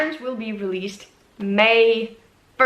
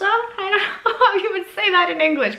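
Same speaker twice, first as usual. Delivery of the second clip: embarrassed laugh; 10 semitones higher, 3 dB louder, faster than usual. Real, not acted. Embarrassed over a pronunciation.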